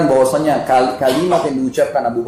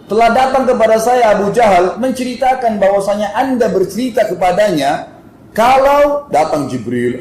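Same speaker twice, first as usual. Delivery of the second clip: higher and louder, half-shouted report; retold loud telling; 6 semitones higher, 4 dB louder, slower than usual. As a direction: tell it loudly so the crowd hears. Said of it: "sambil teriak".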